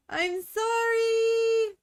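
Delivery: high, loud drawn-out whiny sorry; mock squeaky apology. Streamer to viewers, slow high mock apology for cliffhanger. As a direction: stretch a squeaky sorry very slowly.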